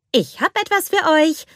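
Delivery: high, happy announcing voice; cheerful announcement. A narrator's reading. Anna brings Gerda and Kai their delivery, cheerfully announcing. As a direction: announce it brightly, pitched well up. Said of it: "verkündete sie fröhlich".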